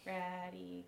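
normal talking voice

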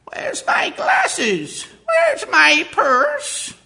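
put-on very high, slow, loud old woman; a very high, slow, loud old woman's fuss; old lady voice. Shown as a demonstration. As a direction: fret like a flustered grandmother.

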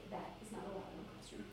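whispered, quick correction; really nice. Shown as a demonstration. Teacher stepping up to a student, really nice and hushed. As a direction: come up close and whisper it kindly. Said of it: "really nice, just come up and say".

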